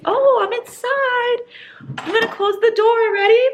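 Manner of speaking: very high, exaggerated sing-out; over-explain it as to a fool; mock demonstration. Reader showing book characters how doors work, high mock-patient lesson.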